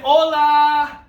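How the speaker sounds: put-on loud, high call for kids to echo; big high sing-out yell; yelled greeting. Shown as a demonstration. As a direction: belt out HOLA to a class. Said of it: "yell it out loud".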